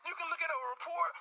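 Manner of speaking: ordinary talk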